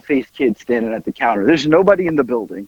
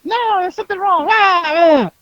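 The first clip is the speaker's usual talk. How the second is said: high, wailing mock panic; [name] losing it; 12 semitones higher than usual. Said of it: "[name] loses it".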